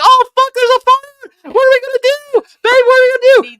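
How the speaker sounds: very high, loud panicked squeal; hammed-up helpless freak-out in a squeaky high voice; mock panic. Man mocking how women react to a fire, shrill panic. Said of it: "You act like that".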